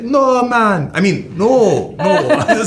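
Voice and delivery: put-on high, laughing Jamaican accent. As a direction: let the Irish slip fully into Jamaican, laughing. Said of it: "my Irish becomes Jamaican".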